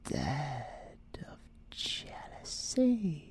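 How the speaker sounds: breathy whispered aside, no voice at all; teasing whisper. Acted. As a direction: lean in and whisper it like a secret.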